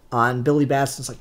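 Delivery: normal talking voice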